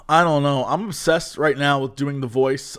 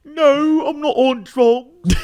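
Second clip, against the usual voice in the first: put-on British accent; 10 semitones higher, 4 dB louder, slower than usual. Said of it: "It's me, [name]".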